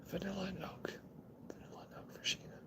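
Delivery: soft whispered tasting note; whispering. Real, not acted. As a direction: whisper it to yourself.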